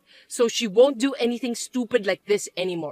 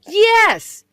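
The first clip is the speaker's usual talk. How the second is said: much higher, loud yes; shrill insistence; 10 semitones higher, 7 dB louder than usual. Acted. Mother insisting to her son, loud and high. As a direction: fire it back at him.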